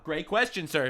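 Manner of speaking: usual speaking voice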